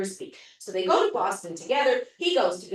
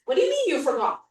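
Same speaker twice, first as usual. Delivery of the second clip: higher, fast, a bit louder disbelief; exasperated; 9 semitones higher, 4 dB louder, faster than usual. Retold or imitated. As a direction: snap back in disbelief. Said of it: "he gets very upset".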